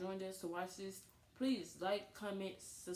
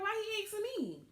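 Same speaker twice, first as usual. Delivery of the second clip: high, pitched-up disbelief; mock outraged question, much higher; incredulous; 12 semitones higher than usual. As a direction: act baffled that he's asking you. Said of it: "And I'm like".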